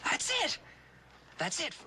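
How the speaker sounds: sudden high joyful shout; excited cry. Real, not acted. Teacher shouts with joy when the girl signs. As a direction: burst out as the breakthrough lands. Child finally gets the sign.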